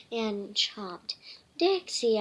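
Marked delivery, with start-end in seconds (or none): 1.6-2.2 high, loud call; shout. Acted. Girl scolding her chewing dog, loud. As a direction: call the dog's name sharply. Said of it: "Emma shouted".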